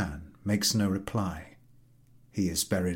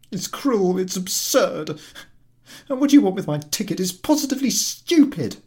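high, loud aggrieved complaint; whining invalid; 12 semitones higher, 7 dB louder than usual. Acted. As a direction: protest like a sick cranky passenger.

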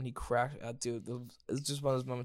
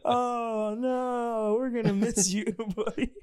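high, cooing mock pity; mock sympathetic counselors; 11 semitones higher, 5 dB louder, much slower than usual. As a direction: play the counselors fussing over the kid, much higher. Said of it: "They were like".

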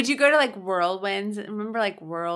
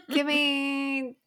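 laughing mock quote; 6 semitones higher than usual. Claire's-style bail officer imitation, laughing.

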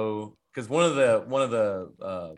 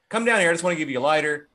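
higher, louder coaxing call, then quick; mock calling out; 4 semitones higher, 4 dB louder, much faster than usual. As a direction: call up to them as the dad, rushing the end. Retold dad calling up to kids.

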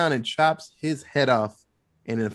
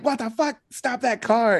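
mock police yell; 7 semitones higher than usual. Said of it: "And the police are like".

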